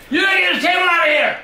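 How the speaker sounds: fast, high, furious yell; angry shout. Real, not acted. Son yelling at grandpa to remove his table, angry shout. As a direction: bark the order at him.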